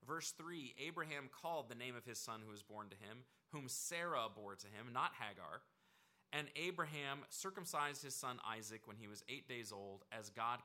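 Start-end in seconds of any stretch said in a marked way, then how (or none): none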